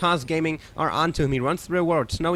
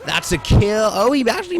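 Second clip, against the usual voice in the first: loud, high hype call; excited casting; 6 semitones higher, 7 dB louder than usual. Real, not acted. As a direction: shout the fight as it happens. Caster hypes a fight.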